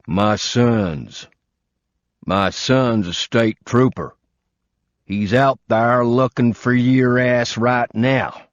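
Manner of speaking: put-on an old man savoring that he has caught a thief; old southern man. Old man telling the intruder his son is a trooper, slow southern gloat. Old homeowner voiced in story.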